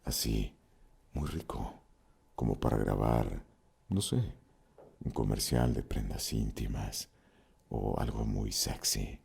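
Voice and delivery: low seductive near-field purr; warm sexy voice. Shown as a demonstration. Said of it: "gordito, calientito".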